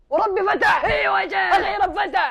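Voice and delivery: loud, high burst of joy; hammed-up jubilant cheer; triumphant shout. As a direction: yell in victory. Scammer's account finally opens.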